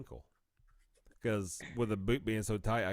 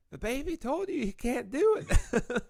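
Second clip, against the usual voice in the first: much higher, louder laughing mom impression; Mama's voice; 9 dB louder than usual. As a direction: squeal it out as an indignant mother. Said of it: "then Mama was like".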